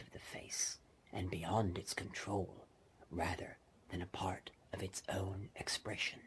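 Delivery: whispered fireside tale; spooky whispered narration. A narrator's reading. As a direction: draw the listener in with dread.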